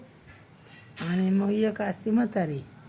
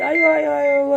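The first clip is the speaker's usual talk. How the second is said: high, loud yelp of pain; 6 semitones higher, 8 dB louder than usual. Acted. Fox yelping after getting burnt, loud and high. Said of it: "ओरडत सुटतो".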